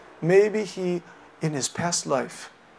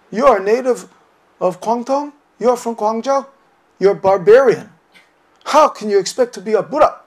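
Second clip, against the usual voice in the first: put-on loud, stern scolding of a young upstart; louder, stern challenge; stern rebuke; 5 semitones higher, 9 dB louder than usual. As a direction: bark it down at him like a severe old master. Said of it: "stern with him".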